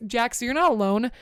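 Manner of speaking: usual speaking voice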